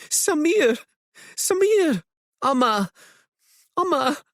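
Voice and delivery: high, tearful calls of relief; crying out in relief. A narrator's reading. Mother reunited with her lost son, tearful relieved cry. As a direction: cry out the child's name with joy. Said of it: "The woman cries".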